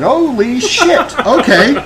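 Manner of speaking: high, slow, stunned disbelief; retold shock. Coworker retelling seeing the wrong route, mock stunned. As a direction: let the surprise stretch out, then laugh. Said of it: "looked at it and said".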